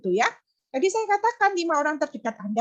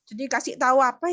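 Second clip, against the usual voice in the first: normal talking voice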